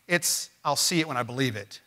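normal talking voice

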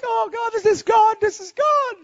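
very high, overcome wail; mock tearful. Man in tears watching grandma walk, high tearful cry. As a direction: act out crying out to God, voice way up. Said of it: "And I'm in tears".

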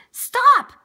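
high, very loud sudden shout; lion tamer's sharp call; shouted command. A narrator's reading. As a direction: shout to halt a runaway. Lion tamer shouts after him.

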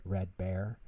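plain reading voice

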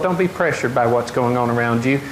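ordinary delivery

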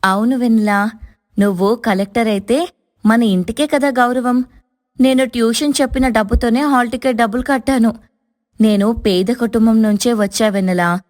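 kind explanation. A narrator's reading. Daughter-in-law explaining to her sister-in-law, tender and sincere. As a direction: reassure her kindly.

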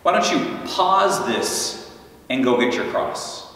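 ordinary talk

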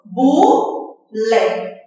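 "Bulé" as two detached syllables. Shown as a demonstration. Teacher repeating for the class, "Bulé" in two beats. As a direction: separate bu from lé in "Bulé". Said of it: "De novo".

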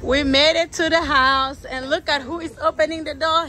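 loud and high, thrilled arrival; excited. Real, not acted. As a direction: burst in full of excitement.

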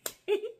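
sudden high bright laugh; burst of laughter. Real, not acted. Laughing at her own story for viewers. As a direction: crack up at the joke. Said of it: "laughs at her own joke".

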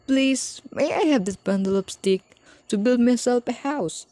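high squeaky piglet voice; sweet, polite little pig asking. A narrator's reading. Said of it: "asked the pig".

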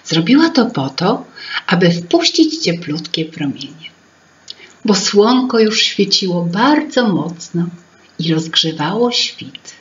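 plain reading voice

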